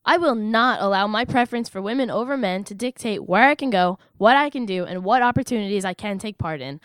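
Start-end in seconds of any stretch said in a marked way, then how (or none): none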